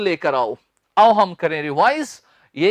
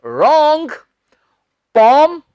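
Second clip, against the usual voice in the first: emphatic correction; 5 semitones higher, 5 dB louder, much slower than usual. Shown as a demonstration. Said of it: "teacher rejects wrong start".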